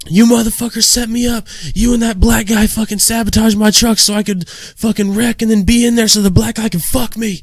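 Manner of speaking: high-pitched paranoid inmate tirade; mock yelling. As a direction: yell accusations like a raving cellmate. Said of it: "he's sitting there fucking yelling".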